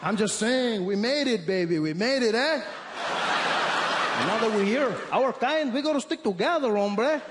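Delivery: put-on high, loud Mexican-accented English, chummy. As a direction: insist like a buddy, with Mexican flavor. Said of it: "He said".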